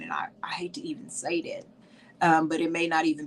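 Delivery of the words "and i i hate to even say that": soft, breathy whisper under her breath; whispered aside. Real, not acted. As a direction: drop to a whisper as if embarrassed. Hesitant aside to live viewers.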